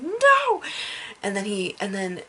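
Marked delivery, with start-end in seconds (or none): 0.0-0.6 high, loud cry of no; anguished no. Retold or imitated. Fan reliving Thanos grabbing the stone, crying no. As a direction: cry out in dismay at the screen. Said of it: "and I'm like".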